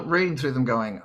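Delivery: ordinary delivery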